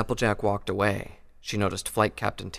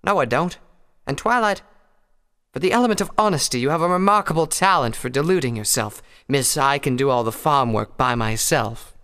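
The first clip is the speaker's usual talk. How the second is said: higher voiced character dialogue; 5 semitones higher, 7 dB louder than usual. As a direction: voice the mare a bit higher. Narrator voices pony character.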